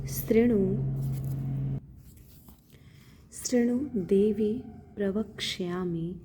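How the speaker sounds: शृणु देवी प्रवक्ष्यामि drawn out syllable by syllable; slow Sanskrit recitation. Shown as a demonstration. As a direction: chant the verse slowly for the learner. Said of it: "प्रवक्ष, आधा क्ष है". (धीमा संस्कृत पाठ)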